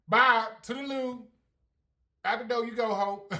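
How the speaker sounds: loud, high, dismissive farewell; sassy send-off. Retold or imitated. Vlogger waving rivals out the door, loud and sassy. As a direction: wave them off at full voice.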